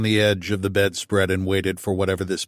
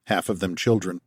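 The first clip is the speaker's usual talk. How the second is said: ordinary delivery, nothing marked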